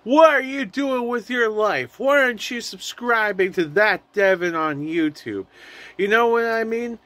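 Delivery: high, pushy ad-pitchman voice; Everest College guy. Acted. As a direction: hound them like a pushy pitchman. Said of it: "hit you with the Everest College guy".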